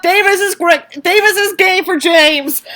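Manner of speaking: plain reading voice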